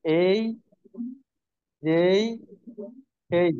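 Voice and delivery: "এই, যেই, খেই" each drawn into an ee glide; model pronunciation. Shown as a demonstration. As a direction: say "এই, যেই, খেই" separately, "খেই" aspirated. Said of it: "আমরা এই তিনটি মোটামুটি বলি". ("এই, যেই, খেই" আলাদা করে, টেনে উচ্চারণ)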